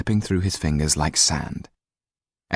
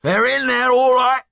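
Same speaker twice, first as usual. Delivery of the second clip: high, loud call to Jan; shouted; 4 dB louder than usual. Acted. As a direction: shout it across the crowd. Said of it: "Didier looked to Jan and shouted".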